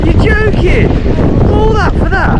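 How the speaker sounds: high, disbelieving cry; can't believe what she just did; incredulous. Real, not acted. Reacts to woman quitting swim.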